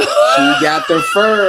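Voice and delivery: loud, slow, laughing; cracking up while repeating it; laughing shout. Real, not acted. Hosts joking about a viewer's fur lady, laughing loud.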